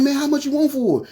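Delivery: high, eager and keen; hardly hiding how badly he wants it; eager question. Teen asking his dad's friend the price, high and eager.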